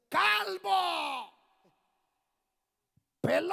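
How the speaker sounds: high, shouted taunt with long vowels; mocking jeer. Boys mocking the prophet on the road, jeering shout. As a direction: holler it like a jeering gang. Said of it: "se burlaban de él diciendo".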